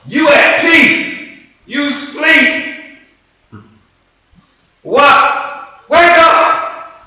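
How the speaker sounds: high, loud, slow frantic yell; panicked shouting. Acted. Disciples yelling at sleeping Jesus to wake up, panicked shout. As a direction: scream at him to wake up.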